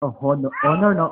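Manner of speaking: usual speaking voice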